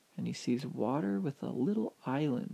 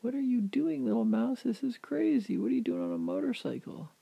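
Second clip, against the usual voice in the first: high-pitched, louder disbelief; baffled, raised-voice astonishment; astonished; 11 semitones higher, 4 dB louder than usual. Acted. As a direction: fire incredulous questions at a tiny creature. Startled man questions the mouse.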